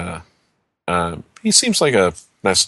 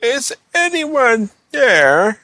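voice behind a door; 13 semitones higher, 4 dB louder, much slower than usual. Retold or imitated. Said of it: "And you just, you know".

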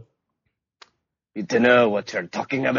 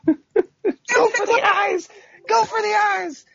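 high battle cry; 4 dB louder, slower than usual. Acted. Shouted Minsc-like battle cry.

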